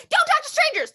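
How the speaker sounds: lightly high warning; a touch of high chicken. Acted. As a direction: pop in with a little high warning. Chicken bursts in.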